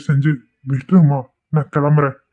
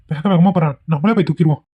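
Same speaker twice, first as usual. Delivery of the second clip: usual speaking voice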